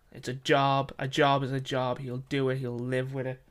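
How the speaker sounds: ordinary delivery, nothing marked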